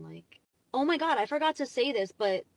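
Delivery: ordinary talk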